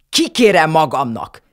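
offended grandmother shrieking. A narrator's reading. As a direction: screech out the offense. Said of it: "visított Nusi nagyi".

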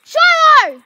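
Shiloh! shouted loud and high; calling out. Real, not acted. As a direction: yell Shiloh! across the yard. Calling the naughty dog.

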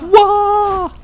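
sudden high yelp; startled yelp. Real, not acted. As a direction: jump and yelp in surprise. Startled by guard in game.

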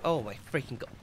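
ordinary delivery

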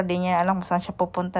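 ordinary delivery

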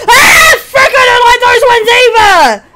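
high, loud frightened yelp into a complaint; startled scream. Real, not acted. Player startled by the game noises, screaming high and loud. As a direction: jump and cry out, then complain.

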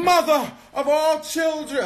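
high, slow, grand diva voice; sassy drag queen. Acted. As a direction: proclaim it like a queen. Grand drag persona declaration.

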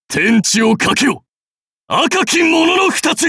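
high, forceful commander's shout; rally the army, building in pitch; rallying cry. Acted. Warlord rallies his army. (軍勢を鼓舞する大将の叫び)

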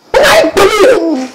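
slow laughing high question. Acted. Pastor laughing at the man's reaction. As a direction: laugh through the words, drawn out.

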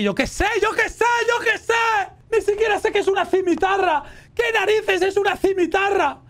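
high-pitched, exasperated protest; exasperated outburst. Real, not acted. Gamer baffled by a quiz about a scimitar, exasperated high outburst.

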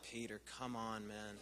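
low, soft, exasperated; a deflated, disappointed let-down; fond exasperation at Peter; exasperated sigh. Retold or imitated.

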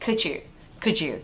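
"could you" blended as "coul-joo"; linked sounds. Shown as a demonstration. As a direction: merge the d and y into a j sound. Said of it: "đọc nối cái âm d".

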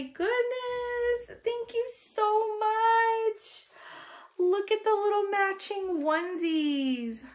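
very high, slow, melting awe; stretched-out high gush of thanks; awed squeal. Real, not acted. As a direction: coo it slowly, overcome with cuteness. Overwhelmed by gift.

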